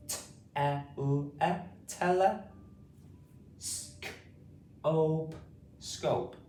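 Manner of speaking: T, E, L, E, then tele, S, K, O, P, then scope; split into syllables. Shown as a demonstration. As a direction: sound out each part, then blend it as tele and scope. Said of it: "split this word up into different".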